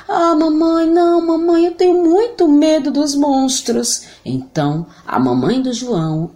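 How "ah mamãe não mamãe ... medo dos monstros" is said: voz de menino aguda e aflita; scared boy voice. Acted. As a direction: implorando com medo. João com medo. (menino aflito)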